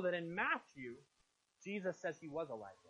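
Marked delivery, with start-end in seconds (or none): 1.6-2.8 lower, softer, falling away at the end; understated. Real, not acted. Preacher quietly setting up another problem for listeners, understated. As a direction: drop the voice to set up the puzzle.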